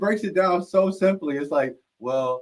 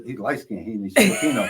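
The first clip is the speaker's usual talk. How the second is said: usual speaking voice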